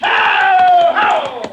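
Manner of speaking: loud shout at the mules. Acted. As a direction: bellow to drive the team on. Driving mules into the river.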